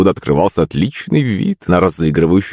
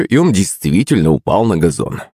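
ordinary delivery

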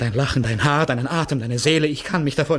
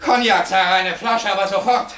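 urgent call; 8 semitones higher, 4 dB louder than usual. Acted. Ordering brandy, shaken.

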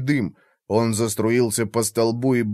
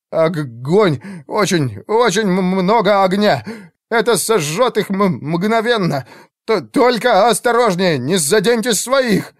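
high, excited stammered warning; agitated stuttering; 7 semitones higher, 3 dB louder than usual. A narrator's reading. Mage warns commander about the fire, stammering urgently. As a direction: warn urgently while tripping on consonants. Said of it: "заикался больше обычного".

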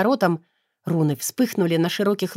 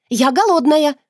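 much higher, louder yell; shouted protest; 8 semitones higher, 6 dB louder than usual. A narrator's reading. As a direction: shout to drown out the vows. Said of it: "выкрикнула я снова". (громкий протестующий выкрик)

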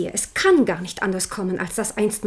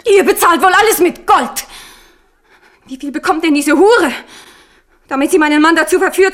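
higher, louder, contemptuous accusation; bitter scorn; 5 semitones higher, 6 dB louder than usual. Acted. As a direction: spit the accusation at her. Accuses her of buying the mistress.

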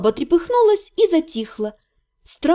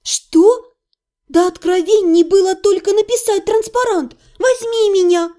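outraged at his slowness; возмущённо; indignant; 6 semitones higher than usual. A narrator's reading. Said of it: "возмутилась я".

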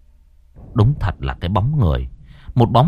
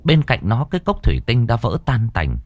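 ordinary delivery

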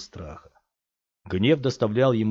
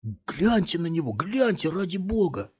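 high, eager urging; excited thug; 9 semitones higher than usual. A narrator's reading. Thug in cap urges friends to look, thrilled. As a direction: point and insist.

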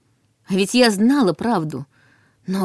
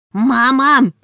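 high growled cry of a dragon cub; growling dragon cub; 5 semitones higher, 5 dB louder than usual. A narrator's reading. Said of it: "прорычала Милана".

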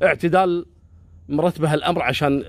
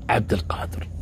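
whispered reveal; 4 semitones lower, 3 dB quieter than usual. A narrator's reading. Dramatic reveal of the stalker.

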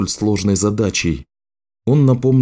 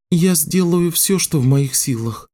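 put-on earnest young apprentice, pitched up; young girl's voice; 8 semitones higher than usual. Girl promises to do her best.

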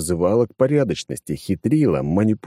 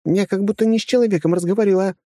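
higher, exasperated woman's voice; complain, cut short; frustrated young woman; 12 semitones higher, faster than usual. A narrator's reading. Frustrated female.

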